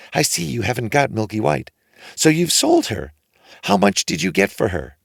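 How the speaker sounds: mother surprised at the door; lightly voiced mother, surprised. A narrator's reading. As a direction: greet him, puzzled. Mother asks eagerly.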